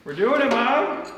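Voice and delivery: put-on very high, loud, slow kid cheer; excited kid voice. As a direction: cry it out like a proud little kid. Excited cry to Mom.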